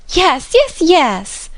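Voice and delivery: put-on high, loud eager chorus of young guests; young people crying out in delight; eager cries. A narrator's reading. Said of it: "cried all the juveniles".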